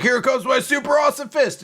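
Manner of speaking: high shouted boast, hammed up; mock hero shout. Recapping the manga, boasting fighter mock shout. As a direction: yell it like a cocky anime fighter.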